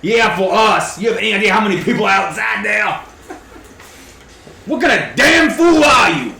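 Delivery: high, loud scolding, building to a shout; hammed-up exasperated shouting; berating adventurer. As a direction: tear into the foolish archer. Player berates archer in character.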